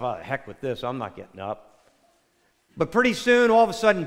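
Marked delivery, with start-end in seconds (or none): 0.0-1.9 low, soft grumble under the breath; muttering to himself. Retold or imitated. Woken husband grumbling to himself, muttering low. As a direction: grumble it low and quiet to yourself. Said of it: "says to himself".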